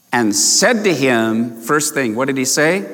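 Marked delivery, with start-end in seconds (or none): none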